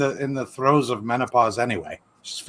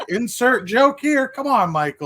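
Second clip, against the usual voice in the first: slow teasing aside; 9 semitones higher than usual. Retold or imitated. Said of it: "I was like".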